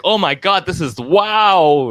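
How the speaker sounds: high, drawn-out awe on "wow"; amazed thrill. Retold or imitated. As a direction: relive being blown away, stretching out the wow. Recalls thrill at first pay.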